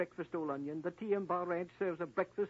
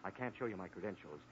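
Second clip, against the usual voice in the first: much lower, softer American agent voice; changed voice; 13 semitones lower, 9 dB quieter, faster than usual. Acted. FBI agent revealing himself to Jane, much lower and softer. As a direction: drop the act and speak as the real lawman. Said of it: "you've changed your voice".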